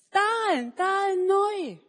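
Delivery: put-on high, loud childlike cry; simple boy shouting about his sandals; Manni's excited call. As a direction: blurt it out with joy. Manni keeps calling.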